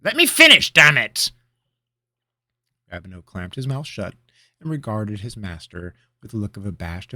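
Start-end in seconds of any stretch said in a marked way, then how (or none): 0.0-1.3 high, loud angry shout; furious outburst. Acted. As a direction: yell it, fed up. Mage shouting in irritation.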